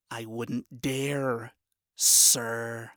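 slow, dry sarcastic reply; deadpan sarcastic voice; dry sarcasm. Acted. Vaggie answers dryly.

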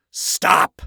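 loud, angry growl. A narrator's reading. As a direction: growl it as a sharp order. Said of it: "Lucifer growled".

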